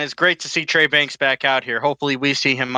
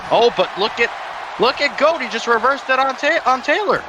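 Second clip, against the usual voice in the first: excited commentator; 10 semitones higher than usual. Real, not acted. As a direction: yell it as the reversal happens. Live wrestling play-by-play call.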